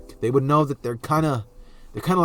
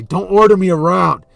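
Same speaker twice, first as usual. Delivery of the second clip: loud, higher-pitched angry retort; irritated shout; 5 semitones higher, 10 dB louder than usual. Acted. Bakugo rushing to the rescue, irritated shout. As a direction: bellow back, refusing to be bossed.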